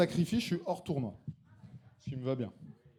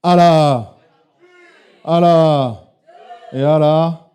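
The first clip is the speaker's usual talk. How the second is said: hype shout; 17 dB louder, much slower than usual. Real, not acted. Host hyping up the jury, loud shout. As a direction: pump up the room.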